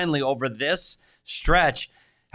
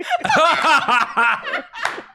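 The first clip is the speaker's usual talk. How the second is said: loud high-pitched laughter; 13 semitones higher, 5 dB louder than usual. Real, not acted. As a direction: burst out laughing. Laughing at the trick.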